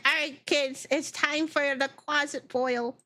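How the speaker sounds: high sing-song mom announcing dinner; cheery parent voice. Acted. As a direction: gather the kids round like a proud parent. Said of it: "They're like".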